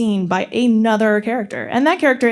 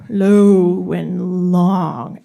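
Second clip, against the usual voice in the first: lower, drawn-out delivery; low and long; 4 semitones lower, much slower than usual. Shown as a demonstration. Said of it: "Would Murgatroyd the mouse sound".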